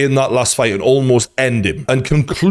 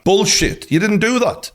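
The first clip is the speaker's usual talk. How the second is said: much higher, scoffing disbelief; incredulous scoff; 10 semitones higher than usual. Real, not acted. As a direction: throw it back at him, incredulous. Scoffing at Fury's boast.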